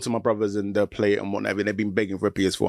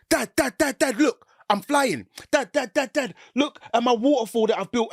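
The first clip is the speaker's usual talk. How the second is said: put-on high eager child calling Dad; be a kid demanding dad look; 13 semitones higher than usual. Child calls dad to see his game, high eager voice.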